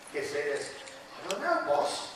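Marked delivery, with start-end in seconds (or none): 1.2-2.1 lightly voiced cartoon sidekick, a little soft; character voice. Shown as a demonstration. Host gives contestant the line to try, lightly in character. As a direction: model the line with a hint of character. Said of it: "Think you can do his voice?".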